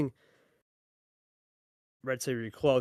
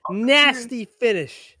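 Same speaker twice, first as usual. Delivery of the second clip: slow savoring call; 6 semitones higher, 5 dB louder, much slower than usual. Real, not acted. Caster savoring finish.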